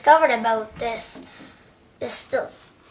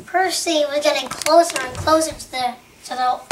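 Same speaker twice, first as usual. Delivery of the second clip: plain reading voice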